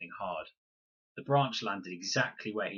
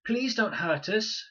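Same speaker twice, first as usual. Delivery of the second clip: put-on high, slow child's plea; frightened child begging from the bush; pleading girl; 12 semitones higher, 6 dB louder, slower than usual. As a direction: beg him not to hurt them. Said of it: "said the bush".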